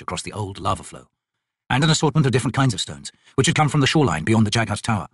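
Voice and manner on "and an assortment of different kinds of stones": ordinary delivery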